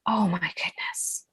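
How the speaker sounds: slow breathy whisper of disbelief; awed whisper. Real, not acted. To the host about her course launch, a slow whisper. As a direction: hush it out, stunned by the memory.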